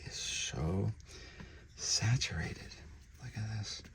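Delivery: a soft breathy whisper of admiration; whispered wonder. Real, not acted. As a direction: breathe the word out in awe. Marvelling at red colour.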